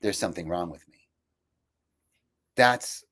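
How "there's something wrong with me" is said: low, soft, resigned; hushed hurt self-belief; inner belief. Retold or imitated. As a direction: say it quietly to yourself. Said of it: "I heard".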